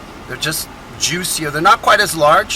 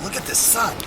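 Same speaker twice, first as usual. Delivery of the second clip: ordinary delivery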